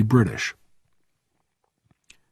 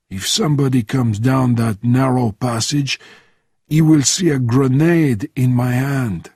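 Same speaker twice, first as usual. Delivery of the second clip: put-on French-accented old hand giving orders; matter-of-fact French-accented instructions; old Frenchman, matter-of-fact; 2 semitones higher than usual. Bernardin explains guarding the room, French-accented and matter-of-fact. Voices Bernardin planning defence.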